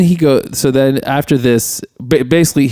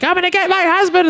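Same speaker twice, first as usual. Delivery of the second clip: mock screamed threat. Woman threatening the drunk caller, in a high mock scream. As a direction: threaten in a squealed imitation. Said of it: "keeps screaming and threatening him".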